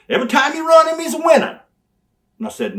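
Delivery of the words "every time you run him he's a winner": much higher, louder boast; loud praise. Retold or imitated. Manager cutting a promo on Brian, loud high boast. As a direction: sell the winner big.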